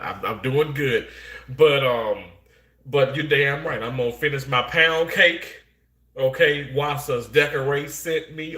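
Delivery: normal talking voice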